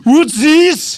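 high, loud incredulous voice; mock outraged. Echoing his partner's bit, loud mock outrage. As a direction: squawk it like a scandalized gent.

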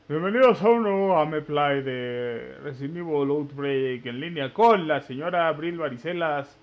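deep show-host welcome; YouTuber intro. Acted. Opens the gameplay video.

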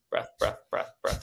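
quick unvoiced popping breaths. Shown as a demonstration. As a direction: speed the pops up. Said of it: "same thing faster".

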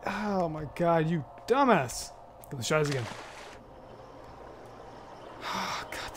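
ordinary talk

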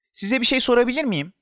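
put-on high child voice, rising question; eager child. A narrator's reading. Boy asking the greengrocer for a moment, eager. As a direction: ask high and keen.

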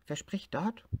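who's there on the line; tiger on the phone. Acted. Tiger asks who is speaking.

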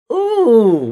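high, loud ooh of delight; impressed ooh. Retold or imitated. Hearing Teddy's new records, a high loud ooh. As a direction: let the ooh fly up high in awe.

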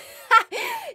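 high squeaking laugh after a voice crack; squeaky laugh. Real, not acted. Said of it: "Did you hear that voice crack?".

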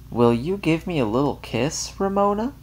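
high sing-song ask; asking the child again, syrupy; sugary coaxing. A narrator's reading. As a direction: coax harder, sweeter. Asks again, sweeter.

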